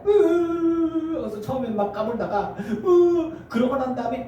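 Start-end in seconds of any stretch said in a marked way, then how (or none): 0.1-1.2 high, drawn-out mock whine; mock toddler sob; imitated child's wail. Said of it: "울어 있다". 2.6-3.3 high, drawn-out mock whine; mock toddler sob; imitated child's wail. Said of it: "울어 있다".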